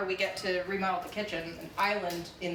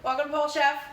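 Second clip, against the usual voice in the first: host intro; 8 semitones higher, 6 dB louder than usual. Acted. Opening the cooking stream to viewers.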